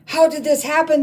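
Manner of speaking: high, loud bewildered cry; shocked cry. Acted. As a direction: cry out in disbelief. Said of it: "they cried".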